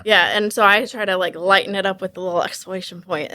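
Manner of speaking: usual speaking voice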